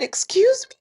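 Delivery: high, loud shocked squeak; shocked excuse me. Retold or imitated. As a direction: play it as indignant disbelief. Shocked retort to market woman.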